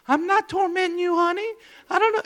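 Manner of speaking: very high-pitched clueless nurse voice; imitated nurse. Nurse answering a hollering patient, high-pitched and clueless. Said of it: "I could hear the nurse saying".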